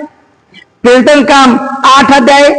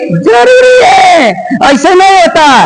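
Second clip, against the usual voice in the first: preaching shout; 6 semitones higher, slower than usual. Real, not acted. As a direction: stretch it out and cry it high. Preacher hammers home necessity.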